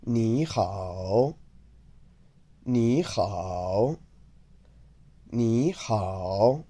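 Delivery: "Ni" cut short, "hao" given the full dip and rise; careful Mandarin demo of "Ni hao"; third tones combined. Shown as a demonstration. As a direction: halve the tone on "Ni", glide into a dipping "hao". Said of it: "the first one of them gets cut in half".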